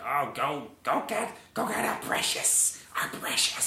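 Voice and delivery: rasped, half-whispered greedy dog voice; imitating a Diamond Dog. Vlogger imitating a Diamond Dog bossing Rarity, greedy rasp. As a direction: hiss it out like a greedy underground creature. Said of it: "And the Diamond Dog says, like".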